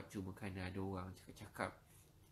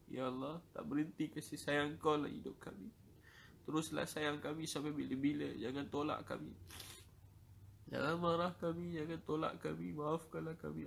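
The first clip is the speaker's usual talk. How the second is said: higher, straining plea on the edge of tears; impassioned pleading prayer; 3 semitones higher than usual. Real, not acted. Preacher begging God for mercy, higher straining plea. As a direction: beg God not to reject them.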